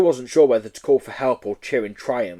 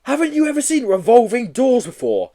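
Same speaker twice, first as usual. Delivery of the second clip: put-on boy voice; 11 semitones higher, 5 dB louder than usual. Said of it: "asked Joe".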